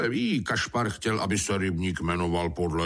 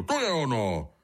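delighted cry of discovery, pitched up; higher-pitched eureka exclamation; exclaiming; 6 semitones higher than usual. A narrator's reading. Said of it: "Kudla vykřikl".

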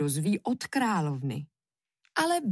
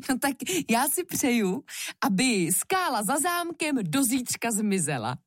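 playful, higher-pitched voice; joking; 5 semitones higher than usual. A narrator's reading. Queen jokingly makes an impossible wish, playful. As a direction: toss out the wish as a jest. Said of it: "z žertu povídá".

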